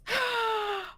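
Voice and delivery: sudden high gasp; sharp high gasp. Acted. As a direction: gasp in shock. Mother realizes mistake.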